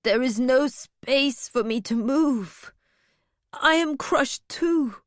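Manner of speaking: slow, plaintive whimper. A narrator's reading. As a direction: complain pitifully, drawing the words out. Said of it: "Vindelia whimpered".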